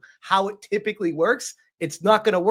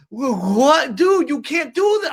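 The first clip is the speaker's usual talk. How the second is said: high, louder outburst of disbelief; retold yelling; 6 semitones higher, 5 dB louder, slower than usual. Viewer yelling at the stream on screen, exasperated. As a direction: yell it in exasperated protest. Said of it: "I'm, like, yelling at the screen".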